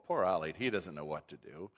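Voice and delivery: normal talking voice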